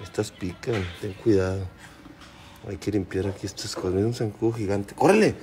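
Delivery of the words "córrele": urgent calling. Real, not acted. Manda al niño meterse.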